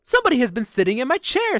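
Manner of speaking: high, loud middle-sized bear. A narrator's reading. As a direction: cry out indignantly as the middle bear. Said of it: "in his middle-sized voice".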